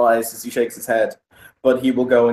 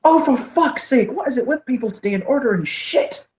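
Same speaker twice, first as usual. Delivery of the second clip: acted exasperation, pitched way up; exasperated outburst; 12 semitones higher than usual. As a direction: blow up at a customer's order in a high, incredulous voice. Barman blows up at drink order.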